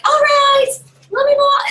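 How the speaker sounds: very high, loud call-out; excited high-pitched hostess call; announcing the draw. Acted. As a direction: shout it gleefully to the whole party. Acting out announcing the drawing.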